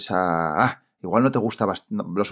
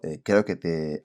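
ordinary delivery

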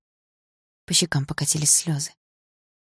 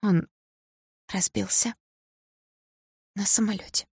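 halting, higher tearful voice; hoarse from tears; 4 semitones higher, 3 dB quieter than usual. A narrator's reading. Sasha finally revealing the crash, hoarse from tears. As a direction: force it out in broken pieces. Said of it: "хриплым от слёз голосом".

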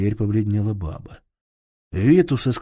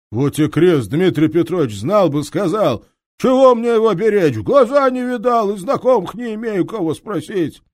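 higher, earnest protest; protesting thief; 12 semitones higher, 7 dB louder than usual. Acted. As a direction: swear you know nothing.

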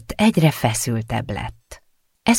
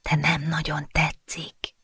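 breathy whisper, no voice; 4 semitones higher, 8 dB quieter, slower than usual. A narrator's reading. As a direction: whisper it secretly.

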